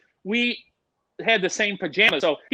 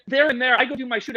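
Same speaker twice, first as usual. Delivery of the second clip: ordinary talk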